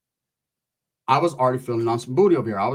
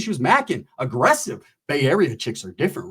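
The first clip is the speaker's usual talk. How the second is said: ordinary delivery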